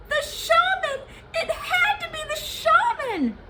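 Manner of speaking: high, loud cry of alarm in his oily voice; alarmed Gozan. Acted. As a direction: blurt the name in sudden fear. Gozan realizes the culprit.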